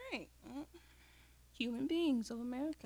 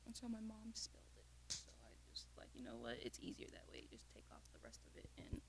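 hushed low whisper; 11 semitones lower, 19 dB quieter, faster than usual. Real, not acted. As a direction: whisper it softly. Drops into a whisper.

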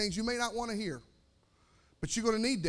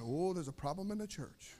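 low, hushed mock murmur; soft aside imitating worried churchgoers; murmuring congregation; 4 semitones lower, 10 dB quieter than usual. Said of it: "Everybody's now going".

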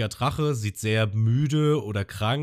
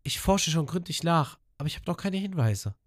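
Abyss-Stimme; 10 semitones higher than usual. Retold or imitated. Abyss spricht weiter.

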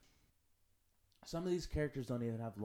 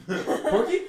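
laughing; 14 semitones higher, 17 dB louder than usual. Real, not acted. Laughing while saying the name.

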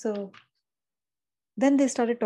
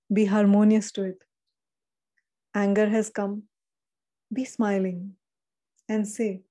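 firm, slightly louder preaching of a divine message; channeled message reading; 3 dB louder than usual. Acted. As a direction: deliver the angels' words with calm authority.

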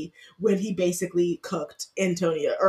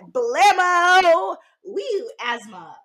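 mock villain; 9 semitones higher, 7 dB louder, much slower than usual. Mock allergies attacking.

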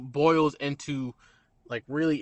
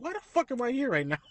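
much higher put-on disbelief; mock bewildered; 11 semitones higher than usual. Waitress wondering why she is with him, mock bewildered. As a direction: throw up her hands in disbelief. Voicing her bafflement again.

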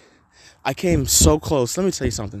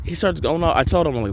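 usual speaking voice

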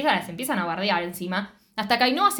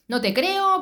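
distrustful Luz; 10 semitones higher, 3 dB louder, slower than usual. Retold or imitated. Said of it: "actúa de Luz como desconfiada".

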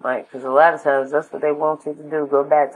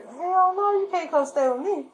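adamant refusal. Acted. Refusing a relative asking to move in, incredulous high refusal. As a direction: shut the request down in squeaky disbelief.

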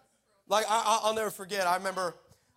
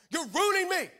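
high, raised-voice protest; retold shouting; 9 semitones higher, 4 dB louder than usual. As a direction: shout the complaint upward at God, acted. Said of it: "I'm shouting at him".

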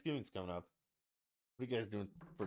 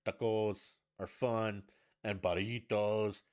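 slow, louder mock-Spanish TACOS and BURRITOS; sudden Spanish accent; 6 dB louder, slower than usual. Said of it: "Where's this accent coming from?".

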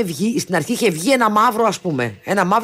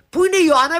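put-on high, raised call for the girl; calling mother; 10 semitones higher than usual. Host mum calling out for Ioanna at the party. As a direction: call out looking for her daughter.

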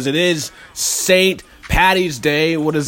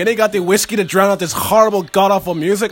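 put-on ranting Irish drunk, laddie-style; mock Irish; 5 semitones higher than usual. Irish drunk mocking Saint Patrick's music, hammed-up Irish accent. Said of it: "Oh, laddie".

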